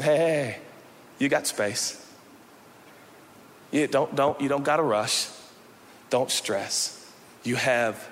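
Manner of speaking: calm a worried friend; soft, tender promise; gentle reassurance. Retold or imitated. Jesus paraphrased reassuring disciples.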